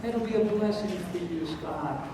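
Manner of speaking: sandpapered, soft edges on every word; mild, quiet, soothing well-wishing; soft, rounded delivery. Acted. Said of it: "rounded the edges on the word".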